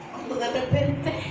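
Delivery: ordinary talk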